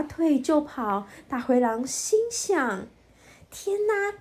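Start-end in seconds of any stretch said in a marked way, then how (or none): 3.5-4.1 high, shaken relieved gasp; scared and whispering to himself; frightened wolf. A narrator's reading. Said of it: "大灰狼越听越害怕".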